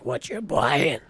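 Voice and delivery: slow, rasping ghoul growl. Shown as a demonstration. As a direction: drag it out like a decayed ghoul merchant. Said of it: "the Fallout Three ghoul voice".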